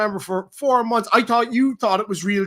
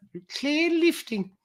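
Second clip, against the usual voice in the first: squeaky quote; 10 semitones higher, slower than usual. Acted. Host echoing a viewer's super chat, "clear lifting" squeaked slowly. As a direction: CLEAR LIFTING high-pitched and drawn out.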